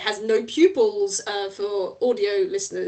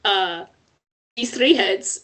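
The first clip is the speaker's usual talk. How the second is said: normal talking voice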